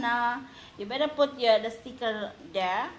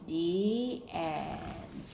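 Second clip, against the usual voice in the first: ordinary delivery, nothing marked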